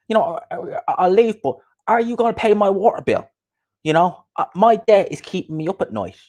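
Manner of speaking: high-pitched mock complaint; Coogan. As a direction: imitate him well above your own pitch. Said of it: "Imagine Coogan had a war".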